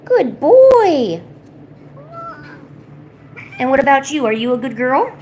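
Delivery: loud, high praise; talking to a dog. Real, not acted. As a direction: praise a pet warmly. Praising pets.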